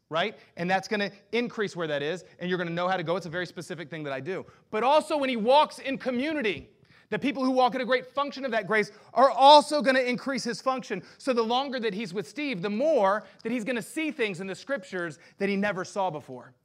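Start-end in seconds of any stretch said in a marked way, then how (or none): 4.7-16.5 higher, louder preaching push; rising, ringing sermon delivery; full-force preaching. Acted. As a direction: preach it hard to the room.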